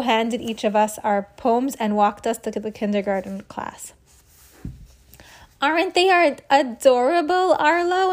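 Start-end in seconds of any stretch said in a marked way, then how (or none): 5.6-8.1 high gushing voice. Acted. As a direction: coo over the little kids. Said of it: "Andrea whispered".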